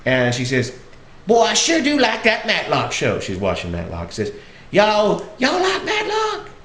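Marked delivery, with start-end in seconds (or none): 1.3-3.2 high-pitched Southern granny enthusing; Southern old lady. Retold or imitated. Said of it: "sweet little old lady". 4.7-6.5 very high, drawn-out granny voice; Southern old lady. Retold or imitated. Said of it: "sweet little old lady".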